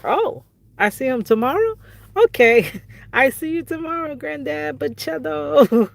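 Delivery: put-on a toddler cheering up all at once; imitating the granddaughter brightening up, laughing; happy little granddaughter. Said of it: "she said".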